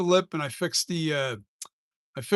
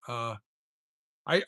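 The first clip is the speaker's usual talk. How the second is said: normal talking voice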